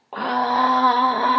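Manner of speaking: sharp, high pushing cry; a loud cry of effort mid-push; labor cry. A narrator's reading. Mother in labor cries out while pushing.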